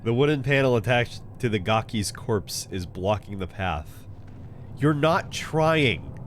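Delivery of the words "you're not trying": slow stress on "not", falling; "You're not trying" stretched out, "not" hit hard. Real, not acted. Mocking the game.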